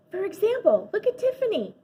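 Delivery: high, eager start; excited. A narrator's reading. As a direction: point her out eagerly. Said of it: "I began excitedly".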